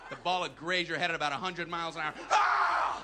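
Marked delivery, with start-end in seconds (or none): 2.3-3.0 high, loud yelp of fright; scared scream. Acted. Batter nearly hit by fastball, scared scream. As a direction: scream as the ball flies at you. Ball grazes his head.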